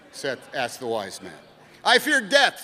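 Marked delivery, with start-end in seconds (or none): 1.8-2.6 anxious pilgrim. Acted. Pilgrim answers the sage.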